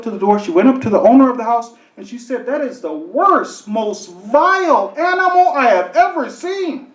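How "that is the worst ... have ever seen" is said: mock furious complaint; angry lady. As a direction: lay down the law to the owner. Angry lady scolds the owner.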